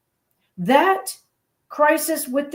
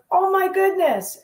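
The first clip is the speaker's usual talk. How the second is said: amazed instructor; 7 semitones higher than usual. Retold or imitated. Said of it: "he goes".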